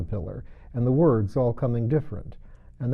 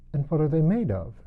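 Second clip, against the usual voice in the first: great curiosity; 6 semitones higher than usual. A narrator's reading. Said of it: "in a tone of great curiosity".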